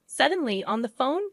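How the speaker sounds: ordinary delivery, nothing marked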